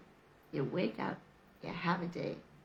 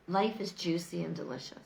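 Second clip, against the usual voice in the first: ordinary talk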